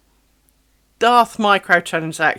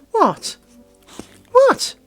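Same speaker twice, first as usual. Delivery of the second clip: pet coo; 12 semitones higher, 5 dB louder, much slower than usual. Real, not acted. Cooing to the dog.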